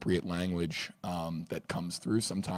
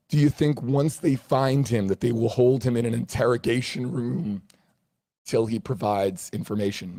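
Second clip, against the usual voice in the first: a raised, loud read with the voice cracking; 5 semitones higher, 9 dB louder than usual. Real, not acted. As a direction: push the question out hard and let the voice crack. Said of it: "My voice is, is cracking there".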